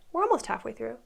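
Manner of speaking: ordinary delivery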